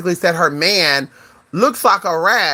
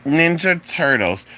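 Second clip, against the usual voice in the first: usual speaking voice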